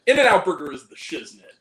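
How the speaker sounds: loud, emphatic burger verdict; loud declaration. Real, not acted. Loud rant to podcast partner about burgers. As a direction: proclaim it to the room.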